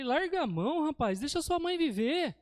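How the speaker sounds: high, loud-edged mock exasperation; exasperated scolding. Preacher recounts telling a worried man off, exasperated scolding.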